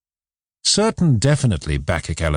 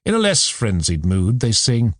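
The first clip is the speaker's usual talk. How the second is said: ordinary delivery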